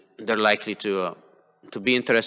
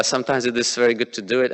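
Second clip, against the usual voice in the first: usual speaking voice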